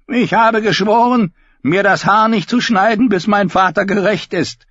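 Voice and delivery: high, loud bleating sheep voice. Acted. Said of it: "klang Davin Lannisters Stimme eher wie das Blöken eines Schafs".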